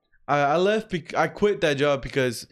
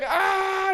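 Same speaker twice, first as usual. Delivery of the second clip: high, crying mock yell of pain. Coworker hit by the tire, high crying mock yell. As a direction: wail like the tire just landed on you. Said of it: "He's like".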